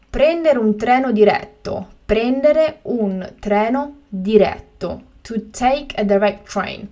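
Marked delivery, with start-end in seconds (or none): 2.1-5.0 slow and carefully spaced; slow, clear repetition. Shown as a demonstration. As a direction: say it again slowly for learners. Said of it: "teacher repeats the word twice".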